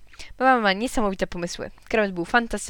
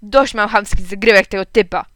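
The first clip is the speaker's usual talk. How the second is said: angry rant; 2 semitones higher, 10 dB louder than usual. A narrator's reading. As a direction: rant at the rude neighbour. Angry father rants.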